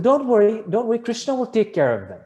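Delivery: high, loud, breezy brush-off; imitated reassurance. Guru answering the worried father, insistent reassurance. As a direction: voice a guru waving every worry away.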